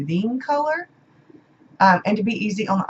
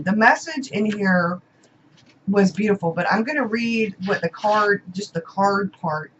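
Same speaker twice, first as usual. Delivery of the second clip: normal talking voice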